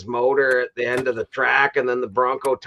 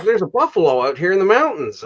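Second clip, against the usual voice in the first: higher, louder burst of disbelief; retold astonishment; 6 semitones higher, 5 dB louder than usual. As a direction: re-enact your own startled discovery. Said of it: "and I was like".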